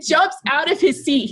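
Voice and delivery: ordinary delivery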